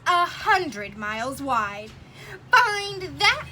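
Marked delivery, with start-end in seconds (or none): 2.5-3.5 a higher, louder taunting sneer; mock the elephant with a sneer; jeering eagle taunt. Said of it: "sneered the bird".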